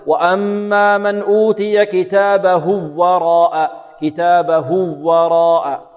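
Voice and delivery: slow recitation with a doubled w between كتابه and وراء; wrongly merged waw. Shown as a demonstration. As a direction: chant كتابه وراء as "kitābahuwwarā", waws fused. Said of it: "ولا ندغم الواو هذه في الواو". (تلاوة خاطئة بإدغام واو كتابه في واو وراء)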